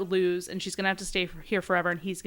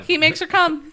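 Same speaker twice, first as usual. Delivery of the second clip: excited; 8 semitones higher, 6 dB louder than usual. Real, not acted. Excited retelling, raised voice.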